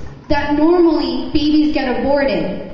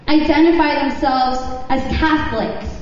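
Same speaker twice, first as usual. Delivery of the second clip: ordinary delivery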